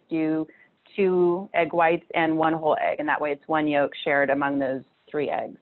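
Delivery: normal talking voice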